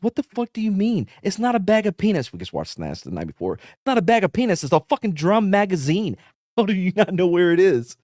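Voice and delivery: hammed-up disbelief at a fool; mock outraged sergeant; exasperated yell. Soldier berates Miller over magazine.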